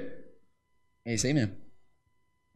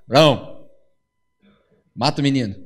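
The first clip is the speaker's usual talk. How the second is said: lower, grave command; a hint of a solemn divine voice; lightly voiced God; 8 semitones lower than usual. (Deus ordenando, voz mais grave e solene)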